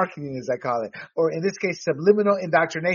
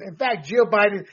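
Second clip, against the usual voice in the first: usual speaking voice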